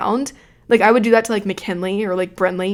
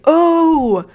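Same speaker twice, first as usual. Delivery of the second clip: teasing ooh; 8 semitones higher, 6 dB louder than usual. Retold or imitated. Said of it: "everyone was like".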